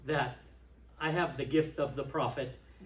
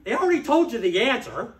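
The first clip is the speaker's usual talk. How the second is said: loud, higher, scolding retelling; exasperated rebuke; 7 semitones higher, 9 dB louder than usual. Retold or imitated. To Lou in the steering meeting, a loud exasperated scolding. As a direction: blast him with frustration. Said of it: "and I said".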